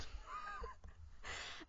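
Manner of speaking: squealing high-pitched laugh. Real, not acted. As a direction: let a laugh break up high and far. Laughs at own joke.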